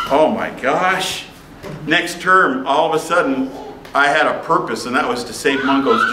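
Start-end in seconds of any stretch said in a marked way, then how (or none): 0.1-1.2 slow, drawn-out amazement; stunned. Retold or imitated. Student learning teacher will risk his job, stunned. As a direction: let it sink in slowly, stretching the words.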